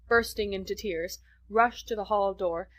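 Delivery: plain reading voice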